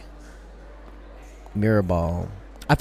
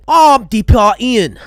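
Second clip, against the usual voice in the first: loud, slow mock Aussie accent; hammed-up Aussie self-introduction; 3 semitones higher, 7 dB louder, slower than usual. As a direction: introduce yourself as a cartoon Australian. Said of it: "your accent sounds more British and Aussie".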